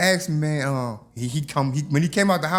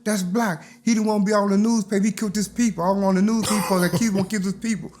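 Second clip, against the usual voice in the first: scared warning; 6 semitones higher than usual. Retold or imitated. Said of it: "They said".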